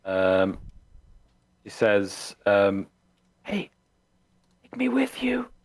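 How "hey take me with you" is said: high urgent whisper. Acted. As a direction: whisper through the door. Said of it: "this door that she whispered from behind".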